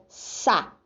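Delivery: "sa" said alone with a hissed S; isolated syllable demo. Shown as a demonstration. Teacher contrasting the S sound for pupils. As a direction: pull out the syllable "sa" with a sharp S. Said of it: "tem som de S".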